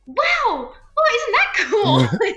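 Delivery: high, drawn-out wow breaking into laughter; laughing mock amazement. Actress retelling her reaction to co-star's accent, laughing amazement. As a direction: stretch it out high, laughing at yourself.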